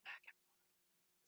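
very soft, voiceless mock grumble; listless whisper. Recalling skipped diary plans, listless whisper. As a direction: whisper it like you've given up. Acts out own past reluctance.

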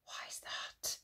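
soft unvoiced whisper; hushed question. Mushy asking Siddy about the door, whispered. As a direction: ask it under your breath.

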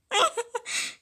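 high, rapid giggle; laughing. Acted. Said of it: "she's laughing a lot to my ears".

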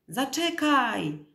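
high, drawn-out, loud call; slow, high-pitched cry to wait; desperate shout. A narrator's reading. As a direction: call after someone getting away, stretching the word. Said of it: "krzyknął".

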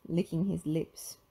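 ordinary delivery, nothing marked

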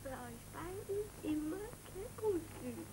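put-on soothing a child. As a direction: hush the baby gently. Mother comforts crying child. (sanftes, leises Trösten eines Kindes)

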